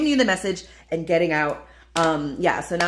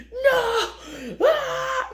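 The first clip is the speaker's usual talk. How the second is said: laughing while faking a shriek of panic; mock scream. Telling viewers about babies on a plane, laughing mock scream.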